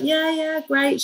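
high, quick nurse voice; breezy staff reassurance. Retold or imitated. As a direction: wave it off cheerily. Staff reassuring about latch.

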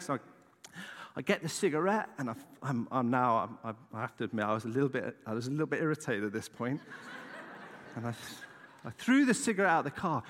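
ordinary talk

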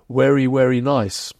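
"werry, werry" with W in place of V, drawn out; Dickensian Cockney. Shown as a demonstration. Historian quoting Dickens's Cockneys, "werry" with a W for V. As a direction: slowly say "very" as "werry". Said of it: "what Mar-, marks Cockneys out is that they say".

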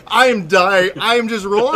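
loud, amused retelling of helpless laughter; reliving a laughing fit; laughing hard. Retold or imitated. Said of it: "I'm laughing so hard".

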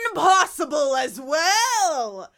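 slow far shout; booming mock shout. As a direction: belt it out. Loud far shouted quote.

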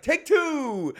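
high, loud call of "Take two"; yelled take two. Retold or imitated. Groom yelling to the crowd to redo the walk, high and loud. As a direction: call it out like a director on set. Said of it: "I literally yell at take two".

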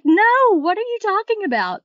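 high-pitched mock indignation; retold protest.

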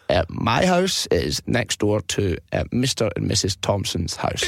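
put-on slow, vague old man dragging each word; old guy voice. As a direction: take your time like a doddery pensioner. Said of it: "he heard it was this old guy".

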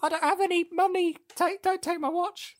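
very high-pitched, timid pleading impression; Bob Balaban. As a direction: squeak it out like a frightened youngster. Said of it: "that's my Bob Balaban impression in this film".

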